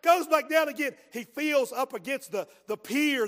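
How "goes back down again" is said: usual speaking voice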